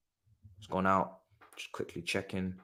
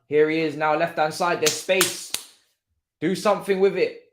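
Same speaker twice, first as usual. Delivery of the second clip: ordinary talk, nothing marked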